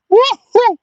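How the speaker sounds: loud joyful woo-hoo; high whoop. Real, not acted. Angler cheering his catch, a high whoop. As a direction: whoop with delight.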